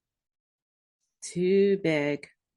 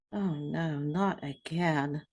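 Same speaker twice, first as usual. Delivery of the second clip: muttered dismay; 5 semitones lower, 7 dB quieter, slower than usual. A narrator's reading. Lunch dropped on loafers.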